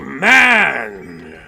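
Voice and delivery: loud, drawn-out bellowed call; manly call. Pretend. Gamer showing off the call of the manly man, bellowed. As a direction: belt it out like a mock battle call.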